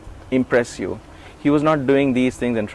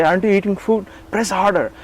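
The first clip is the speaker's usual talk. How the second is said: higher, impatient scolding master; a hint of a stern guru; stern guru's demand; 8 semitones higher than usual. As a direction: push them like a demanding teacher. Retelling Swami's stern demands.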